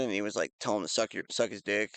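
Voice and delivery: normal talking voice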